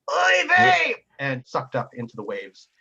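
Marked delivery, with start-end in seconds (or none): 0.1-0.9 high, loud exasperated cry; hammed-up yelp as he's blasted; comic oy vey. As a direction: wail it as he's hit. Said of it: "He goes".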